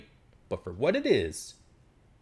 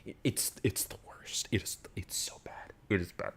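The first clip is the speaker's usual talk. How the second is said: whisper; 7 dB quieter than usual. Real, not acted. Hushed verdict after yelling.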